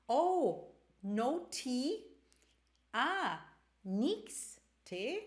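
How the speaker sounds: normal talking voice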